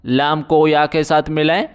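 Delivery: normal talking voice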